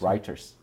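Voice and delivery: low-key attempt at "Reuters" as RYE-ters; attempted English pronunciation. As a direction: repeat "Reuters" with an EYE vowel, quietly. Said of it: "is that too Scottish".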